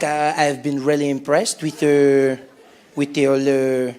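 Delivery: put-on slow, low French-accented hesitation. French person praising the company, slow, sexy French accent. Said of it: "When a French person says it, it's sexy".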